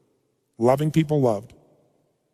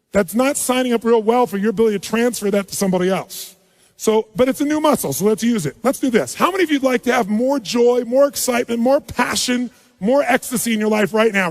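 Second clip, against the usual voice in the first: normal talking voice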